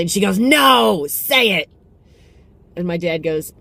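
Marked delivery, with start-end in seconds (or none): 0.4-1.7 high, loud scolding mom voice; insistent motherly correction; mom correcting. Retold or imitated. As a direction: correct him sharply like a mother. Mom correcting dad retold.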